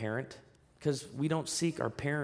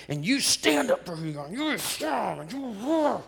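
raised mock-empowered voice; 8 semitones higher, 3 dB louder than usual. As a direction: sneer at the roar, pitch climbing. Mocking culture's view of strength.

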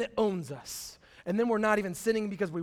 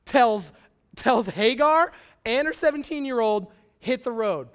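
emphatic retelling; 3 semitones higher, 8 dB louder than usual. Retold or imitated.

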